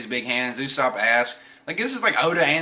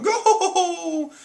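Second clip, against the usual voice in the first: playful cheer; 3 dB louder than usual. Real, not acted. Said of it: "laughs and cheers".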